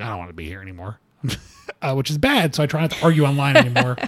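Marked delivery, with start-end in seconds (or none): 0.0-1.0 much softer and lower, all fight gone; defeated mumble. Retold or imitated. Arguing online and instantly giving up, soft low defeated mumble. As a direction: let it sink out of you, beaten.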